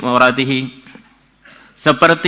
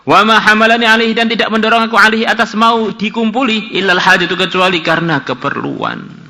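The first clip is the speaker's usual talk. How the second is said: higher, louder pleading woman; the woman's voice; 9 semitones higher, 4 dB louder than usual. Acted. The woman explains her desperate need to Kifl, higher.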